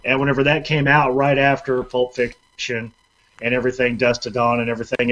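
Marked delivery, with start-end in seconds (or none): none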